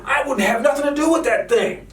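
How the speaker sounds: sarcastic imitation of Biden and Harris; mocking quote of politicians. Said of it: "Biden and Harris were saying".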